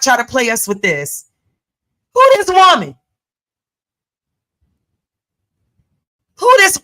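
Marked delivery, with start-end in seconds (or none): 2.1-6.8 put-on much higher, louder Jamaican-accented call; mock Jamaican accent. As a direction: shout "Who this woman?" in a hammed-up Jamaican accent. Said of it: "I wish I had a good Jamaican accent".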